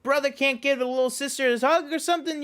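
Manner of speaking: put-on high, lightly done New Jersey accent. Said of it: "he talks with a New Jersey accent".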